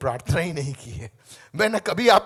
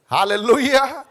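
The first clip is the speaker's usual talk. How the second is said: shouted louder hallelujah leading the crowd; 4 semitones higher, 10 dB louder than usual. Real, not acted. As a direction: call it out loud so they repeat. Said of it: "जोर से बोले". (ज़ोर से hallelujah)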